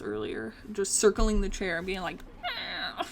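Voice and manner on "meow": high, whiny mock meow; imitated cat scream. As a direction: mimic a cat yowling for attention. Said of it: "he will just walk around and scream at me".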